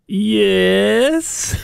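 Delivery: imitated affected phone answer; put-on phone voice. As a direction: stretch it out in a put-on telephone voice. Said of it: "put on a different voice".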